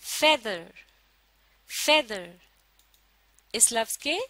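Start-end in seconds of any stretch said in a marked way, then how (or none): 0.0-2.3 feather with a strong फे and a falling, lighter दर; teacher's model feather, first half stressed; stress on first syllable. Shown as a demonstration. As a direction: punch the फे of feather. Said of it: "पहले हिस्से को जोर देकर बोला जाता है".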